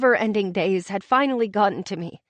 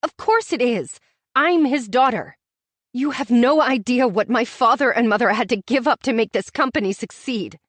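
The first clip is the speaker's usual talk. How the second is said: raised voice; 3 semitones higher, 6 dB louder than usual. A narrator's reading. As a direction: raise your voice at him. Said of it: "I raised my voice".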